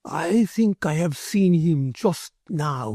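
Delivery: slow and courteous, a little aloof; measured Spanish merchant. Acted. Ramon answers Carlos.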